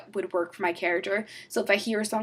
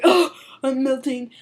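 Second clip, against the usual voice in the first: slow, louder, hammed-up dying groan; mock melodramatic wilting; mock melting Superman; 4 semitones higher, 9 dB louder than usual. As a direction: ham up a melting hero. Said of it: "he's like".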